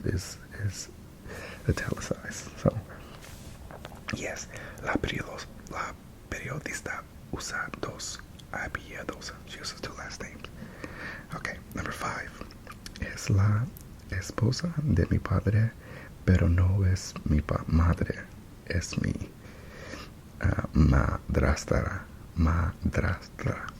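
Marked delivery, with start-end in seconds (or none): 1.7-17.6 breathy ASMR whisper. Real, not acted. 22.4-23.7 madrastra whispered slowly; whispering. Real, not acted. Repeats word voiced then whispered.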